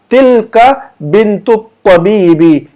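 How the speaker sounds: Tilka Bintu तबीबी drawn out very slowly; slow model Arabic. Shown as a demonstration. Teacher slowly modelling Tilka Bintu तबीबी for students. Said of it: "इसका जुमला यूं बनेगी".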